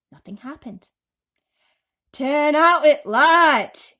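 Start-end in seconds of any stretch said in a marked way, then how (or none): 2.1-3.9 loud, slow, stretched call. A narrator's reading. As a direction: raise your voice across the dark room. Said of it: "he called, raising his voice".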